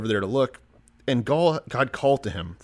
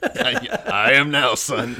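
mock God voice; 5 semitones higher, 7 dB louder, much slower than usual. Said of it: "answering as God".

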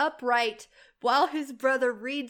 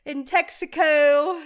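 town crier; 2 semitones higher, 8 dB louder, slower than usual. Pretend. Mock proclamation, laughing.

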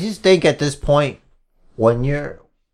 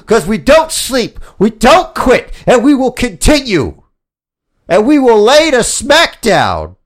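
loud, higher promo shout; wrestling promo; 6 semitones higher, 3 dB louder than usual. Acted. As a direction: fire up the crowd in a promo. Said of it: "like I'm doing a wrestling promo".

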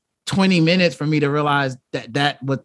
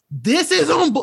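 high, straining mock yell; imagined inner shout; 12 semitones higher than usual. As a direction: inner voice half yelling. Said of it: "it might as well be yelling".

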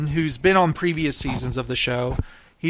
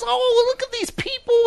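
very high, cooing mother delight; doting mom voice. Retold or imitated. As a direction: gush over strangers like a doting mom. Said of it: "she talks just like that".

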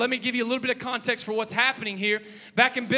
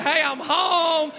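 drawn-out, higher hollered homecoming; proud announcement; 4 semitones higher, 4 dB louder, much slower than usual. Acted. Husband pulling into the driveway with new toys, triumphant holler. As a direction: call it out big, stretching the last word.

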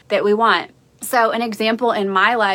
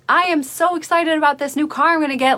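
higher, quick retold eagerness; excited son; 6 semitones higher, faster than usual. Son asking his mom about the new car, acted excitement. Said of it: "being full of excitement".

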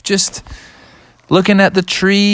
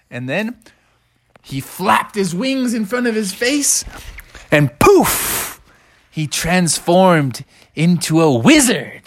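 usual speaking voice

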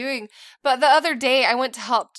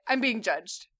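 usual speaking voice

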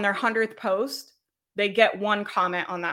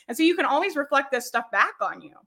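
usual speaking voice

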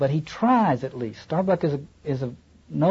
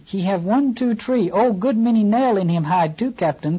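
higher, halting broken English; disjointed pidgin English; 7 semitones higher than usual. Acted. Queequeg crying to the captain in broken English. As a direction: cry it out in Queequeg's choppy pidgin. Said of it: "cried Queequeg disjointedly".

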